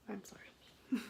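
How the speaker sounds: very soft whisper; whispered apology. Real, not acted. Storyteller says sorry for naming her friend, whispering.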